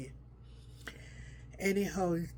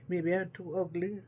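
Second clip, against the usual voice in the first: ordinary delivery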